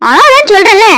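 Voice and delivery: high, loud reprimand; insist, out of patience; exasperated scolding. Acted. Mother ordering baby to stop crying, exasperated scolding.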